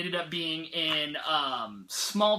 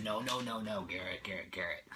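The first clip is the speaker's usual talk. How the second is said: low, hushed coaxing friend's voice; pleading friend; 10 semitones lower, 9 dB quieter than usual. Retold or imitated. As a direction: coax him like a pushy friend. Friend urging him to read.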